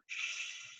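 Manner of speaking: soft, breath-only shush. Acted. Player shushes the guard he sits on, soft shush. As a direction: hush someone quietly. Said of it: "sit on him and go".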